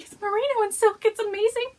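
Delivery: high, loud gushing; excited reply. Retold or imitated. Answering a friend's doubt about the yarn, high gushing. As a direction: insist it's the best blend. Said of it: "I'm like".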